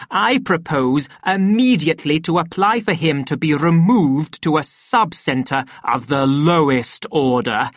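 weighty formal accusation, key words punched; pompous director denouncing. Acted. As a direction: address the assembly with grave self-importance.

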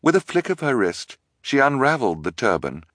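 plain reading voice

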